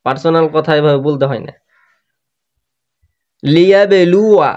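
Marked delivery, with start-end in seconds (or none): 3.4-4.5 slightly raised, drawn-out chanting delivery; Quran recitation. Shown as a demonstration. Quran teacher building up the phrase for learners, measured recitation. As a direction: recite in measured pieces, holding the long vowel.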